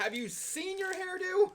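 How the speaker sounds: high, slow mock-incredulous tease; teasing high squeal. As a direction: rib her about her hair. Teasing guest's hair.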